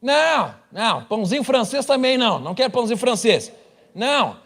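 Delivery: high pitched muddled denial; confused Brazilian. Retold or imitated. Pastor mocks a Brazilian who mishears French, high pitched.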